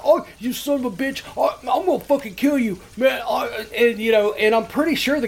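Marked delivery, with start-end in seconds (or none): 0.0-3.2 higher, acted-out furious threat; retold angry caller. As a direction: imitate a man losing his temper. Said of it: "this guy's getting mad".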